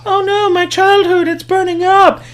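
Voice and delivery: very high, hammed-up wail of loss; mock dismay. Viewer mourning the burning old car, hammed-up high wail. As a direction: squeak it like a mock heartbroken fan. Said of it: "and you're supposed to go".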